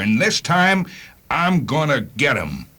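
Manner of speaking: spikes high on this time, then a slow, heavy promise; fierce vow. Acted. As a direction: swear revenge through the line. Vowing revenge on the Devil Car.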